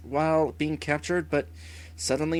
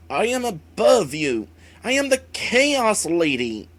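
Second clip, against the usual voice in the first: louder and higher, proud and furious; defiant villain boast; 4 semitones higher, 7 dB louder than usual. Acted. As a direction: proclaim it over your enemy. Wraith boasting while wounded.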